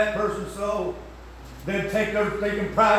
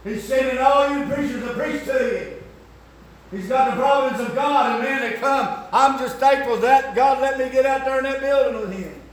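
1 preaching at full force; 8 semitones higher, 5 dB louder than usual. Real, not acted.

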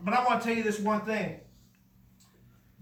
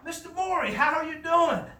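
friendly doctor greeting; 7 semitones higher than usual. Retold or imitated. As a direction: greet an old patient brightly. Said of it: "He goes".

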